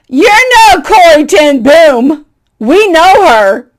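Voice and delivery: loud, slow, high mock reproach; teasing put-down. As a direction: tease her with a grin. Friend teasing the guest.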